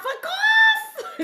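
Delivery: very high, stretched screech; chicken squawk. Retold or imitated. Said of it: "Like a fucking chicken".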